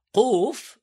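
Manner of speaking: loud, high קוף from the back of the throat; back qof. Shown as a demonstration. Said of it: "הוגים ק".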